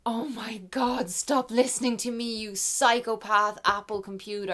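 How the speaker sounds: slow, stunned, then scolding; shocked scolding. Real, not acted. Vlogger shocked at her laptop butting in, scolds it. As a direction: tell off the nosy computer in disbelief.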